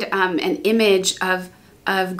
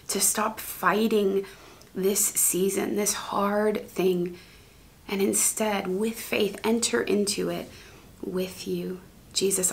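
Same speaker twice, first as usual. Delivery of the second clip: soft, hushed pastoral prayer; softer closing prayer, sinking to a whisper; quiet prayer; 5 dB quieter than usual. Acted. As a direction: pray tenderly over the listeners.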